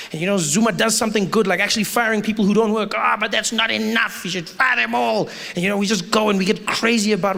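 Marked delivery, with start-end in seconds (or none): 2.9-5.3 slow, drawn-out mock moan; complaining. Citizens grumbling about the president's firings, mock complaining. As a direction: lightly imitate someone never satisfied. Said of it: "We love to complain".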